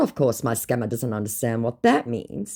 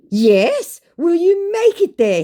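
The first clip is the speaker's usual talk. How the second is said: much higher and louder, overeager; eager mock scammer; 8 dB louder, slower than usual. Scammer pushing her to come, eager put-on voice. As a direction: chirp it like a keen hustler.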